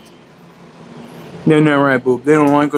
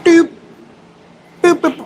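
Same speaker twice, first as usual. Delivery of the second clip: much higher, louder squeak; squeaky boops; 4 dB louder than usual. Real, not acted. Booping the dog's nose.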